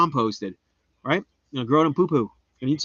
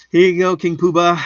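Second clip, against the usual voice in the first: mock grand; 4 semitones higher, 7 dB louder than usual. Mock royal address.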